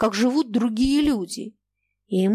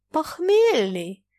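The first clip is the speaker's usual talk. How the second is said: one word stretched through a yawn, pitched up; 7 semitones higher, much slower than usual. A narrator's reading. Sasha answers Foma's question about himself, yawning. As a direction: answer slowly and high, as if mid-yawn. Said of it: "зевнув, ответила Саша".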